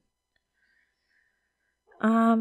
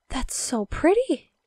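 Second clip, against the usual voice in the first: slow, breathless awe; awed gasp; 6 semitones higher, slower than usual. Real, not acted. Gasp at banner result.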